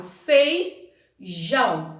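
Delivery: "feijão" split wide, a clear pause between fei and jão; separated syllables. Shown as a demonstration. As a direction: break "feijão" into two slow pieces. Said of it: "Eu separo".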